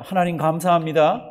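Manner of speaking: loud and clear for the congregation to echo; call to repeat. Shown as a demonstration. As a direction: say it out so they can follow. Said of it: "절 한번 따라 하십니다".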